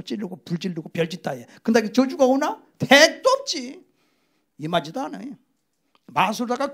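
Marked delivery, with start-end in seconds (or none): 2.8-3.8 slow, high, drawn-out scoff; scornful dismissal. Real, not acted. Preacher scoffing at the curse. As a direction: stretch it out in disbelief. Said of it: "emphatic scornful dismissal".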